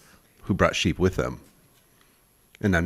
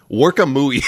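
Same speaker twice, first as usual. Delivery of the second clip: "Work a mooey" said in a light put-on foreign accent, pitched a bit up and louder; a hint of a foreign accent, played for laughs; "that's not my sandwich" accent; 5 semitones higher, 5 dB louder than usual. Podcast host reads out a strange word in a lightly put-on accent. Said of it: "That's not my sandwich" accent there".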